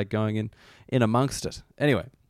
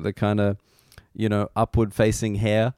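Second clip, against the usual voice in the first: ordinary talk, nothing marked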